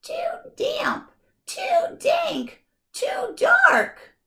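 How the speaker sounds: very high squeak, rising to a shout; tiny mouse squeaking in fright; squeaky mouse. Acted. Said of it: "Mouse squeaks".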